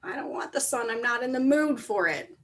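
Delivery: mock grumbler. Teacher imitating somebody who slaps the sun, mild mock grumbling. Voices a sun slapper refusing.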